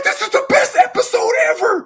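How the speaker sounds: put-on squealing high, loud kid glee; thrilled kid. Kid watching cartoon yelling in high excited glee. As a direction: yell it like the best cartoon moment ever. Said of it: "I'm like".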